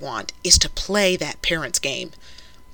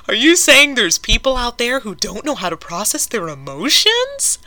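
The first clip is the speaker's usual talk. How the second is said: higher, louder, mock-shocked question; mock disbelief; 6 semitones higher, 5 dB louder than usual. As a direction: ask it in fake astonishment. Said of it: "I can't even finish that with a straight face".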